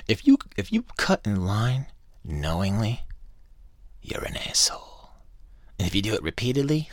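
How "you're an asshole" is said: hushed, voiceless whisper; whispered insult. Real, not acted. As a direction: whisper it with contempt. Muttered insult at line cutters.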